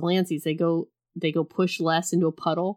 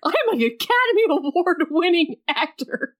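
mock indignation; 10 semitones higher than usual. Mock Bale defending himself.

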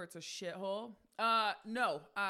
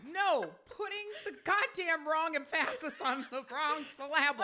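loud, higher-pitched protest; exasperated; 6 semitones higher, 7 dB louder than usual. Real, not acted. Arguing with co-host over emphasis, loud exasperation. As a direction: insist over the other person, worked up.

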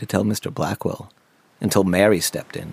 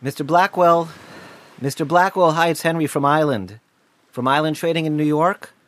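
higher-pitched, tentative call to announce himself; 6 semitones higher than usual. Acted. Employee announcing himself to his boss at the door, calling out. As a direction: call through a doorway to get attention.

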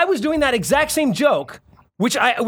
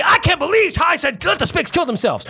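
indignation feinte; 6 semitones higher than usual. Retold or imitated. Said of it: "Would I be".

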